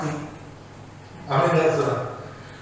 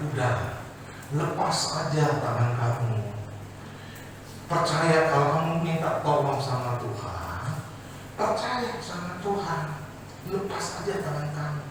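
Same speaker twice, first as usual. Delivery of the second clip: calm angel; 7 dB quieter than usual. Acted. Angel speaks calmly.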